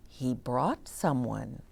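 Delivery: ordinary delivery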